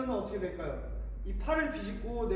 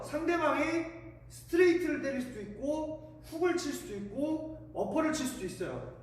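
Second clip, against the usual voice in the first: normal talking voice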